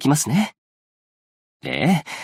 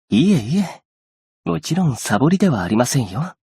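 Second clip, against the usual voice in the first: ordinary talk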